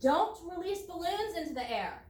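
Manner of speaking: normal talking voice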